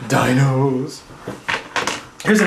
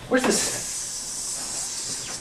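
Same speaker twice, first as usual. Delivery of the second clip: slow whisper; 11 semitones higher, 10 dB quieter, much slower than usual. Acted. Drawn-out whisper searching.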